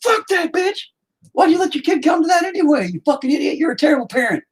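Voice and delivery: very high shrieking rant; teacher screaming at a parent, high ranting voice; mock rant. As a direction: screech insults at the parent. Himself yelling at parent.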